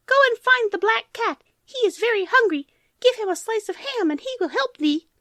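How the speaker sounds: very high squeaky mouse voices; squeaking mice. Acted. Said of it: "gratefully squeaked the mice".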